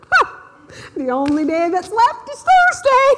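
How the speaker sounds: high, loud laughing exclamation; giddy laugh, high and loud; laughing disbelief. Retold or imitated. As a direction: crow it out through laughter. Phone rings yet again.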